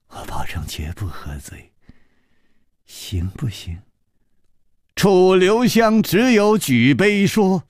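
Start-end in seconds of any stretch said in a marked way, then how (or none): 0.1-3.8 soft, low, coaxing voice; hushed, low-pitched playful plea; amused coaxing. A narrator's reading. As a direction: promise it softly, just after a giggle. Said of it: "石秀云扑哧一笑".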